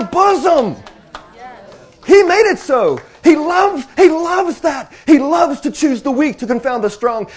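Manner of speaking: loud, high-pitched preaching at full force; impassioned preaching. Real, not acted. Preacher exulting to the saints about God living within them, impassioned preaching. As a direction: build into exultant, emphatic proclamation.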